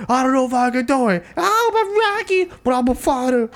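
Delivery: very high, hammed-up wail; emotional outburst imitation. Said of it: "every emotional outburst is".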